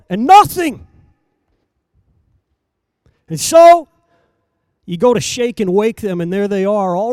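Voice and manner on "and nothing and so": louder, higher preacher's cry; fiery preaching. Acted. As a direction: proclaim it to the congregation. Preacher at full force.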